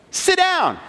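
very high-pitched mock yell; high, sharply falling command, no extra volume; retold coach's yell. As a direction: imitate a coach ordering a player down. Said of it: "the coach yells at him again".

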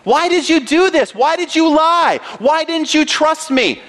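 God questioning Abram, disappointed; pleading rebuke. Pretend. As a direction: let each why land like disappointment. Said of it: "God's saying to Abram".

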